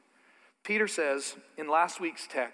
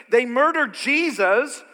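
full-force preaching; 6 semitones higher, 7 dB louder than usual. Real, not acted. Preacher building to a climax for the congregation, loud. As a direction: lean in and hammer it home.

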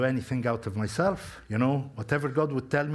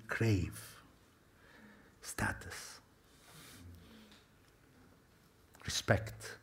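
whispered, almost breathless; 5 semitones lower, 11 dB quieter, much slower than usual. Real, not acted. Preacher naming what people crave, whispered. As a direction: let the words fall to a whisper.

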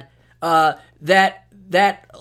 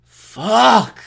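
loud, higher drawn-out swearing; exasperated curse; 6 semitones higher, 8 dB louder than usual. Acted. Host acting out himself hearing the alarm, loud exasperated curse. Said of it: "I used to do that myself".

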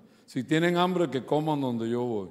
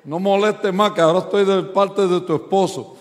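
louder, higher, exasperated telling-off; retold angry scolding, raised voice; fed-up outburst; 5 semitones higher, 7 dB louder than usual. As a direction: snap at her to go to bed. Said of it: "Ya estaba loco por decir".

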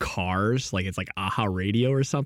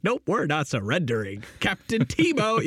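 high, slow defiant teammate; lightly mock a stubborn gamer; 7 semitones higher, slower than usual.

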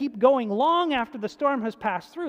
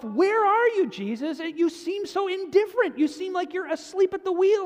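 pleading to Jesus, pitched higher; imitated desperate prayer; 8 semitones higher, 3 dB louder than usual. Preacher voicing a troubled believer, pleading upward. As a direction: cry out to an absent Jesus. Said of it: "you think to yourself".

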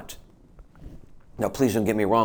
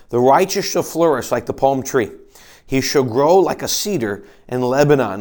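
scripture reading; 3 semitones higher, 7 dB louder than usual. Acted. Reading scripture aloud from pulpit.

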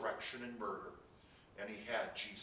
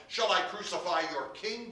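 loud, raised voice; challenging question; 7 semitones higher, 7 dB louder than usual. A narrator's reading. Pilate challenges the shouting crowd, loudly. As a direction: throw the question back at the crowd.

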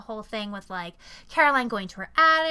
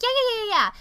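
high, loud string of brush-off yeahs; dismissive agreement; 11 semitones higher, 6 dB louder, faster than usual. Retold or imitated. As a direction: rattle off yeahs to brush someone off. Friends brushing off a movie request.

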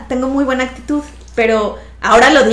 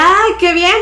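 amused doctor; 7 semitones higher, 4 dB louder, slower than usual. Retold or imitated. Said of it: "él se reía y me decía".

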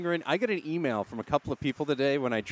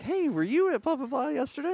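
much higher, bright quoted colleague; high coworker voice; 12 semitones higher than usual. Retold or imitated. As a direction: play the chirpy new colleague, pitched up high. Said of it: "she's like".

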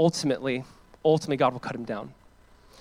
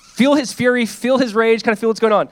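fervent urging; 7 semitones higher, 8 dB louder than usual. Real, not acted. Preacher urging congregation to feel.

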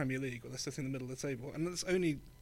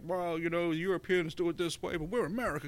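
higher, louder mock American accent; 4 semitones higher, 4 dB louder than usual. Parodying the MLS commissioner in a brash American accent. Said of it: "Is this your American accent right now?".

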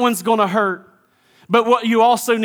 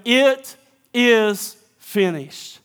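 slow final words; much slower than usual. Acted. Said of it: "I want you to tell them".

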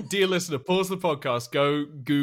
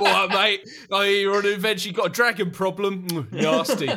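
louder, higher put-on tradesman voice; mock plumber; 6 semitones higher, 4 dB louder than usual. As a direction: play a chatty plumber sizing up a job. Acts Bilbo as a plumber.

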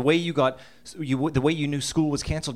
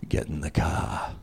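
much lower, slow, menacing Boston growl; Darth Vader dad; 10 semitones lower, slower than usual. Retold or imitated. Dad summoning kids on Saturday morning, low slow menace. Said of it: "like Darth Vader with a Boston accent".

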